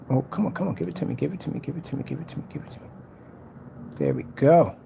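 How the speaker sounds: ordinary talk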